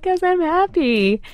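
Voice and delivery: high, slow, teary mock sob; telling her son why she cries; happy crying. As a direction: whimper it through happy tears. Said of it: "hysterical crying".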